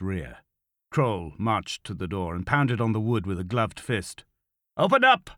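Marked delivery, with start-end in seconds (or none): 4.8-5.3 high, loud bark; barked order. A narrator's reading. Officer pounding on the door, barking to open up. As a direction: bark it out as a command. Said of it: "he barked in Greek".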